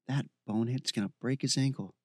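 ordinary delivery

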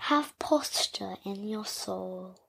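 slow breathy whisper. Acted. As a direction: whisper it like a secret. Whispered closing line.

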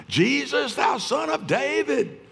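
high desperate shout to Jesus; shrieking cry. Retold or imitated. Blind Bartimaeus calling out to Jesus, high shout. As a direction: cry out high and hard, begging to be heard. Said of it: "He shrieked, he cried, he screamed".

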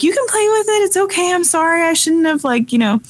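put-on dad soothing his teary toddler, high and gentle; apologetic soothing. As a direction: coax a hurt child back to play. Husband apologizing to a crying toddler.

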